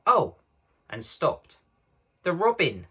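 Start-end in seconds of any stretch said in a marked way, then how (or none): 0.1-0.4 sudden high startled cry; cry of surprise. A narrator's reading. Girls startled in the wood, sudden cry. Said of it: "both the girls suddenly cried out". 2.2-2.9 high excited cry; cried out. A narrator's reading. Lucy spotting the robin, excited cry. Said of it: "cried Lucy".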